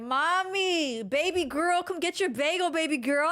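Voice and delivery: loud, high catcalling New York voice; imitating New York guy. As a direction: call out like a Queens bagel guy. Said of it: "they were like".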